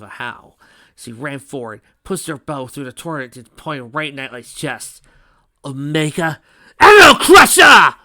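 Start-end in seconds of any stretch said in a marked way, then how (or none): 5.6-7.9 high, drawn-out attack shout; battle shout. Acted. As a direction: bellow the finishing move. Hero yells attack name.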